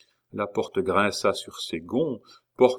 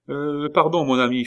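louder, higher polite hail; calling out; 7 semitones higher, 6 dB louder than usual. A narrator's reading. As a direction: hail a stranger to ask a favour. Chicot interpelle la sentinelle. (interpellation polie, voix forte)

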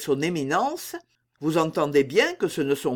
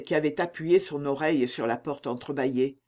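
plain reading voice

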